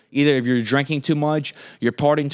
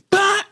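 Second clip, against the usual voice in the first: sharp yelled "Bah!", much higher and louder; sudden yell; 4 dB louder than usual. Retold or imitated. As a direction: blurt a startling bark as he slams the brakes. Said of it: "He goes".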